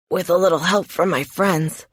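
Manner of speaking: strained, hurting reply; voice full of pain. A narrator's reading. Injured man asked to get up, pained voice. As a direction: say it through pain, understated. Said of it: "His voice expresses his pain very clearly".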